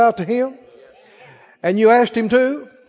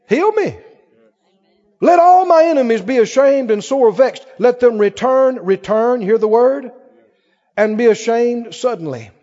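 raised, rhythmic preaching read; preached scripture; 3 semitones higher, 4 dB louder than usual. Acted. Preacher reading the psalm to his church, raised. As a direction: proclaim the verse to the room.

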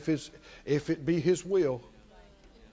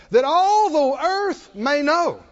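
proclamation; 8 semitones higher, 5 dB louder, slower than usual. Retold or imitated. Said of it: "Not that I can fight".

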